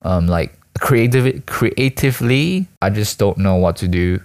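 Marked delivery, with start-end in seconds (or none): none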